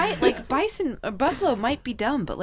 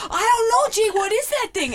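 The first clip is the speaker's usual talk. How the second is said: higher and louder, bewildered; imitating a friend stumped by a sunfish; baffled friend; 11 semitones higher, 5 dB louder than usual. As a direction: ask it totally lost. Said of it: "Jay goes".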